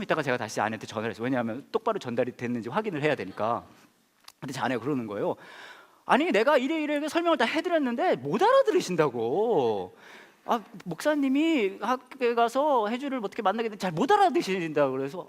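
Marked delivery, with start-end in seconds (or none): 6.0-14.9 imitating a frustrated wife, high-pitched; mock complaint in a raised voice; exasperated. Wife reports the mum cannot understand, mock exasperated.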